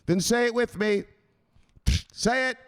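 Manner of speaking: high, loud mock tough-guy scolding; stern enforcer. Enforcer scolding a jittery crypto investor, high and forceful. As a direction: lay down the law to a nervous investor.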